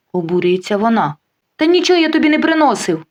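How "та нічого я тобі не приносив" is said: shout. Acted. Husband yells his denial at the mistress, high mock shout. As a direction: protest at the top of his voice. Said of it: "кричить Володя".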